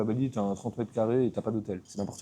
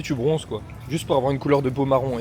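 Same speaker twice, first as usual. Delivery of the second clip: normal talking voice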